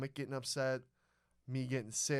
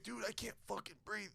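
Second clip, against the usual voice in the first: high, soft, choked-up imitation; retold breathless plea; 6 semitones higher, 8 dB quieter than usual. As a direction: act out struggling for air, thin on volume. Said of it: "I'm even, like, crying".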